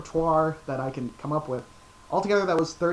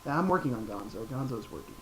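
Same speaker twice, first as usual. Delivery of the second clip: normal talking voice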